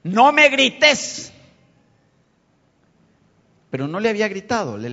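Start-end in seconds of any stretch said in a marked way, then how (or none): 0.0-1.3 loud, higher, drawn-out objection; raised voice, slow and emphatic; acted protest. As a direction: act out someone protesting at being yelled at. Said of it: "la otra persona le dice".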